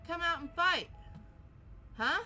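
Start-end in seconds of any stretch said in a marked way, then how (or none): 0.1-0.9 taunting challenge. Real, not acted. Challenging hidden game creature.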